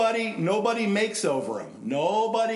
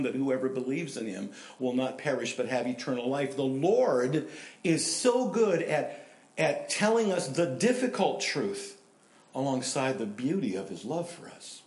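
usual speaking voice

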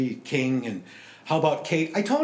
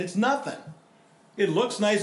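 ordinary talk

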